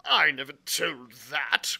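loud, slow, forceful protest; indignant cry. A narrator's reading. Squire denying he leaked the secret, crying out. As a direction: cry out in outraged denial. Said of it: "cried the squire".

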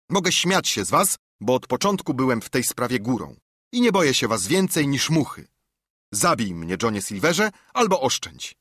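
lightly acted defiant declaration; defiant young captive. A narrator's reading. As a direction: stand up to your captors. Narrator acts Jim's defiant speech.

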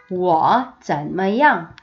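very slow, each syllable drawn out; slow choral reading; slow read-along. Shown as a demonstration. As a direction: read slowly with the class. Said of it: "같이 해요".

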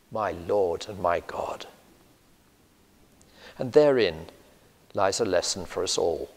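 ordinary talk

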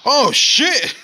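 loud, high startled cry; shocked yelp. Real, not acted. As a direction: blurt it out in shock. Another sudden game death.

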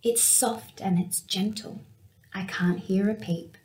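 ordinary delivery, nothing marked

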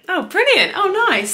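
delighted squeal of relief; thrilled relief. Real, not acted. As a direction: let the good news explode out of you. Thanked after booking succeeds.

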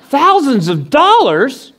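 high, drawn-out disbelief; comically stunned question; incredulous echo. Retold or imitated. Stunned echo of teller's answer.

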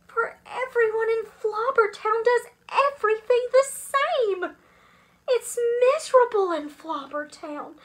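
high, loud complaint; acted dread of a dreary town; dismayed protest. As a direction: keep up the appalled outcry. Children's horrified protest continues.

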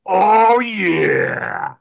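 loud, rising delighted exclamation; gleeful oh yeah. Retold or imitated. Jesus vacuuming souls in the clouds, gleeful. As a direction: relish it like a satisfied vacuum ad. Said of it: "Jesus with a vacuum cleaner in the clouds going".

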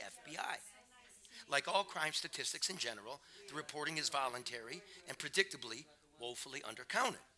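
measured, emphatic book reading; nonfiction narration. A narrator's reading. Author reading a section on deaths by police, emphatic narration. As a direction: read it out firmly, landing the key words.

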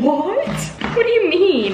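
high, loud disbelief; baffled, can't believe it; incredulous what. Real, not acted. Friend mixes up two books, high disbelieving what. Surprised at the book mix-up.

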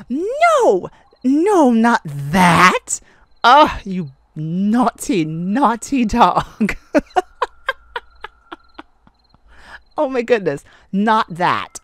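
loud, slow, giggly no not that; flustered protest. Acted. Flustered partner scolding the naughty wolf, loud and slow. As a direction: drag out the naughty dog, scandalised.

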